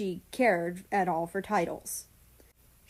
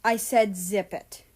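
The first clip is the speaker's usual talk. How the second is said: commanding; 4 dB louder than usual. Acted.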